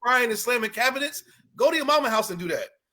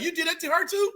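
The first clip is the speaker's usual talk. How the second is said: mimicking the wife; 8 semitones higher, 3 dB quieter than usual.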